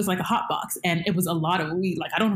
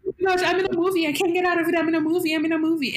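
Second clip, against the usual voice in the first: high, fast mock panic; freaking out; 10 semitones higher, faster than usual. Stoned woman convinced she's in a movie, mock freak-out. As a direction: rattle it out high as if losing your mind. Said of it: "me freaking out and everything and losing my mind".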